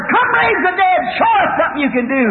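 high, jeering crowd call; acted high-pitched heckle; hollering. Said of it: "The other holler".

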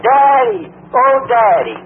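high, loud, pleading cry; pathetic hollering. Retold or imitated. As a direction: imitate a lost girl crying out for her father. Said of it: "I heard somebody hollering".

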